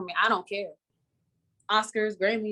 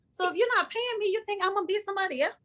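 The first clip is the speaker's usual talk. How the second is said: incredulous; 10 semitones higher, faster than usual. Real, not acted. Incredulous rhetorical question.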